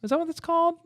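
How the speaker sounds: ordinary delivery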